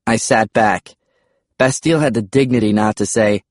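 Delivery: usual speaking voice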